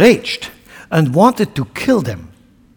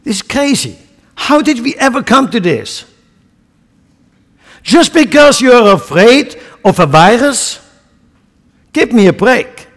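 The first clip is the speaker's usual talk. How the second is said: higher, incredulous outrage; indignant disbelief; 8 semitones higher, 5 dB louder than usual. Real, not acted. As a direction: scoff at the idea from the pulpit. Preacher mocks fear of virus.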